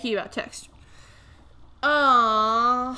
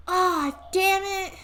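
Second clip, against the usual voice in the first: dismayed; 8 semitones higher, slower than usual. Real, not acted.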